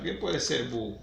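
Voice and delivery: ordinary delivery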